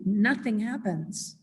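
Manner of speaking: ordinary delivery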